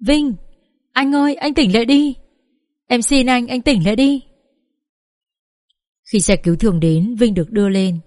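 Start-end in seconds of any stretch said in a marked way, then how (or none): 0.0-4.2 a tearful wail, pitched much higher. A narrator's reading. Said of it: "không kìm chế nổi cảm xúc và gào lên". (gào khóc van xin, giọng cao vút)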